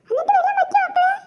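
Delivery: high, loud alarmed question; alarmed mother. Acted. Mother hearing her daughter fell, alarmed and loud. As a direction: gasp it out, suddenly worried.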